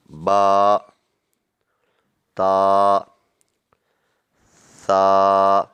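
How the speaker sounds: slow drawn-out letter names, each one held; letter drill. Shown as a demonstration. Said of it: "الف".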